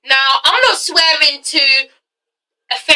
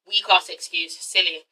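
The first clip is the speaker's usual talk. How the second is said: muttered aside; 6 semitones lower, 9 dB quieter than usual. Real, not acted. Muttering to himself after rant.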